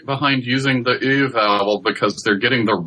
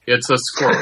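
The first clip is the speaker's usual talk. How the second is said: usual speaking voice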